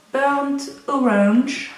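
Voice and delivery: French-accented English, drawn out; English accent attempt. Real, not acted. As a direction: say "Burnt Orange" slowly in accented English. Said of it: "Mon super accent".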